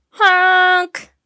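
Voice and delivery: blaring bus horn imitation; a loud, drawn-out horn blast; loud horn honk. A narrator's reading.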